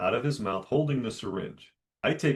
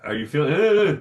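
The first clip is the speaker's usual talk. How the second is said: high, loud mocking grunts; mock his wordless grunting; mocking the kid; 6 semitones higher, 9 dB louder, faster than usual. Dentist mimicking the kid's grunts, high and loud. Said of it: "So I said".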